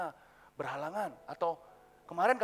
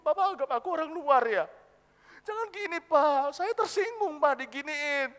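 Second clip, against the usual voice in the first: high, hurt wail building louder; crying protest; 4 semitones higher, 7 dB louder than usual. Acted. Child hurt that father treats them as a stranger, tearful protest. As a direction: protest tearfully, rising to a loud cry. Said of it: "saya nangis".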